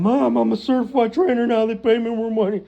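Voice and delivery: high, loud mock brag to mom; boastful son. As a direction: puff up like a wonder boy phoning mom. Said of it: "Every week he calls his mom".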